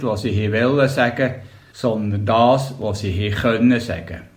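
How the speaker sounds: plain reading voice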